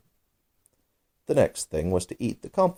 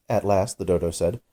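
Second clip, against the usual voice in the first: ordinary delivery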